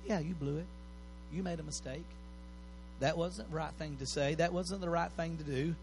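gentle conviction. Acted. Preacher voicing the Spirit's conviction, soft and tender. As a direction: gently own the mistake, no blame. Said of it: "But conviction says".

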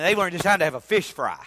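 normal talking voice

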